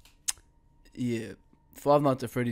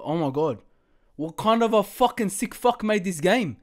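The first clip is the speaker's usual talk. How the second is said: ordinary talk, nothing marked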